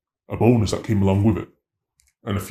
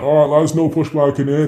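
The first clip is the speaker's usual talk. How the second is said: louder, higher call to the team; 7 semitones higher, 7 dB louder than usual. Retold or imitated. Officer calling to colleagues after search, loud call. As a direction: announce it to the room.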